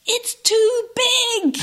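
very high, loud, drawn-out cry; mock child wail. Boy booted out the back door, high mock wail. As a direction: wail it like an overwhelmed little boy. Cartoon boy wailing at the outdoors.

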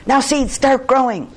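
high, loud storybook shout. A narrator's reading. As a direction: shout the order at the top of a character voice. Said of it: "close to the ground and shouted".

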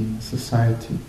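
ordinary talk, nothing marked

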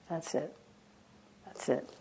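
near-silent meditation cue; soft guiding murmur. Real, not acted. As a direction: guide them gently into stillness, very softly. Quiet guiding, settling into awareness.

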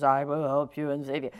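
low-pitched divine reassurance; God voice. Acted. God promising to save the man, deep voice. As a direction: speak deep, as if from the heavens. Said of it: "I have to put on my God voice".

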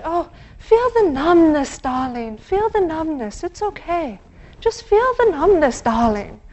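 put-on high, loud, doting German accent. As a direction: coo it like a German teacher in a German accent. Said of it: "so I always hear it in a German accent".

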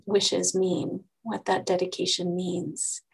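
ordinary talk

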